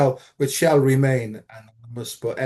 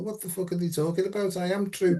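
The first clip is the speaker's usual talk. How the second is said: quick, higher scornful thought; raised, hurried inner protest; fast inner thought; 5 semitones higher, 5 dB quieter than usual. Retold or imitated. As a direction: rattle it off, baffled. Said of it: "And I thought".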